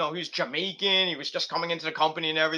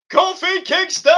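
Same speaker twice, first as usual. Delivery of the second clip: high, loud drawn-out announcer-style shout; big singsong high-pitched hype; hyped name call; 13 semitones higher, 7 dB louder than usual. Pretend. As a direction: belt out the wrestler's name like a ring introduction. Hypes the wrestler's name.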